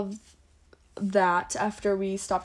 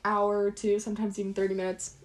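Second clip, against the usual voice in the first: ordinary talk, nothing marked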